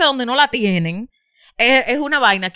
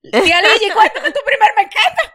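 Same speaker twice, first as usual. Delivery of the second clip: high, loud, laughing handoff; laughing cue; 11 semitones higher, 6 dB louder than usual. Real, not acted. Host laughing as she invites aunt Ligi to share. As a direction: cheerfully pass the turn while laughing.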